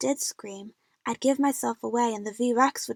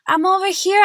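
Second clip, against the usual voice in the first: high, loud desperate scream; lost in the jungle, calling for rescue, screaming; 8 semitones higher, 9 dB louder than usual. A narrator's reading. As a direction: yell it out for anyone to hear. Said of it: "I screamed".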